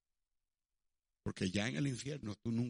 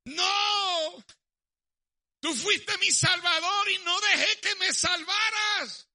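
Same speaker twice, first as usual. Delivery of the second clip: very high, very loud slow wailing shout; a sinner cast into the lake of fire, crying out; anguished cry; 10 dB louder, slower than usual. Acted. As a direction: scream of the condemned at judgment. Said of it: "van a gritar".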